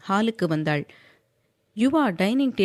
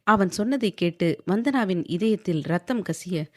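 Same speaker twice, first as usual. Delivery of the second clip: usual speaking voice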